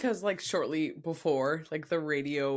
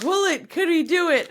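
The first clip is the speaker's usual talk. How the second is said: excited; 13 semitones higher, 11 dB louder than usual. Retold or imitated. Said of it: "It was like".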